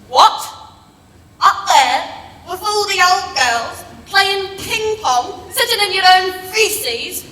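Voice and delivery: loud, rising indignant protest; indignant outrage. Acted. Gran refusing the old people's home, loudly indignant. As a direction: bristle at the very idea.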